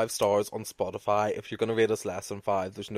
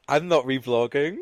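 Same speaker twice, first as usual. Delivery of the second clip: usual speaking voice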